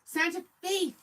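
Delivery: high, delighted "that's it"; excited recall. Real, not acted. Thanking Kat for the city name, high and delighted.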